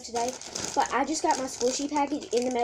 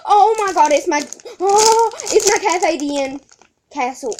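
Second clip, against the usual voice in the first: excited squeal; 7 semitones higher, 9 dB louder than usual. Real, not acted. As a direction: gush over the new squishy. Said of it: "Sorry if I squeal a lot".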